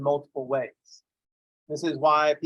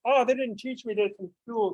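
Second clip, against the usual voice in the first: whiny excuse; 6 semitones higher than usual. Retold or imitated. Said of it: "don't try to blame someone else".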